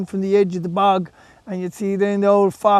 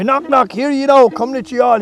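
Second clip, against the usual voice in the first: high, loud town-crier call; puck crier; 5 semitones higher, 6 dB louder than usual. Acted. Puck the goat announces the party, high and loud. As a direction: call the gathering like a loud herald. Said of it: "he'd be".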